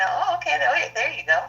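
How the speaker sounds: high, sing-song "oh okay" with a knowing lift; mock knowing reply. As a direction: play it like you saw it coming all along.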